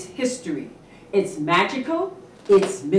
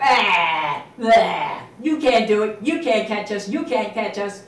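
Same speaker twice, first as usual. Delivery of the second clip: put-on taunting kids; 7 dB louder than usual. Children taunting the cat.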